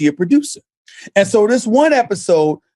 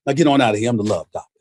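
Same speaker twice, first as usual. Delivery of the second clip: low, soft Love Doctor voice, sinking lower at the end; Love Doctor impression; 12 semitones lower, 4 dB quieter, much faster than usual. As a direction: drop into a deep smooth Love Doctor send-off. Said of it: "he'd go like this".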